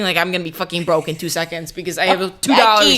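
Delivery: loud, climbing rant toward a shout; hammed-up frantic yelling; frantic retold plea.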